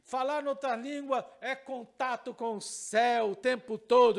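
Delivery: loud rising sermon climax; preacher building to a loud closing cry; preaching at full force. Acted. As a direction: drive the point home to the congregation.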